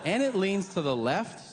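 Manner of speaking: ordinary talk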